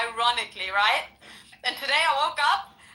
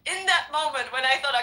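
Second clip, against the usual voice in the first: ordinary delivery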